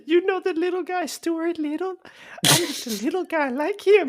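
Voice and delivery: high-pitched small character; little guy voice. Acted. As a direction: introduce yourself as a tiny fellow. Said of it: "your best little guy voice".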